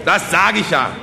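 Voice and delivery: high, loud, triumphant appeal; eager village orator. Acted. Kosonossow asks peasants for money, eager oratory. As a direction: pounce on the opening and proclaim.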